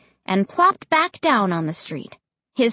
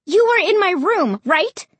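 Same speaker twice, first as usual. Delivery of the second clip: high, loud and insistent; 10 semitones higher, 4 dB louder than usual. A narrator's reading. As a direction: press him for the truth. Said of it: "I insisted".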